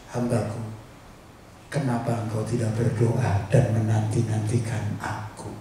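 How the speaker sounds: soft, low imitation of the Holy Spirit; inner voice of God. Holy Spirit speaking to the preacher's heart, hushed and low. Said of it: "Roh Kudus itu bilang di hati kecil saya".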